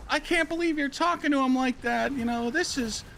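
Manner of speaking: imitated girlfriend, very high-pitched protest; mock scolding in a squeaky-high register; mock indignant yelling. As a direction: put on a high, outraged woman's voice. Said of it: "his girlfriend starts yelling".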